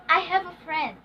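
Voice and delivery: statement with "friend" dropping in pitch; falling intonation. Shown as a demonstration. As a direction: let the voice fall on "friend". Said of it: "We use falling intonation".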